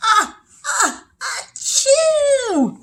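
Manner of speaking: huge high storybook sneeze, building up; big fake sneeze. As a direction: build up to a loud, long achoo. Acting out the bear's sneeze.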